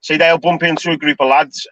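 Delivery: ordinary delivery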